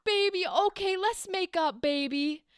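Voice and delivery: high, eager mock make-up plea; gushing lover. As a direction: gush to a sweetheart in parody. Acting lovers making up.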